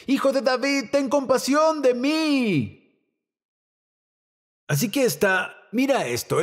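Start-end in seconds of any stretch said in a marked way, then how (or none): 0.1-2.8 high, insistent plea with a stretched ending; acted beggar's cry, pitched well up. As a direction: call out for mercy over the crowd. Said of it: "él gritó aún más fuerte".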